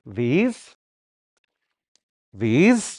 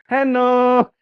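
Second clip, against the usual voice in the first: high singsong hello; Kushi's greeting; 9 semitones higher than usual. Acted. Imitating Kushi's same old greeting, high singsong. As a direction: call it out on a held high note. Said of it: "you go home and Kushi".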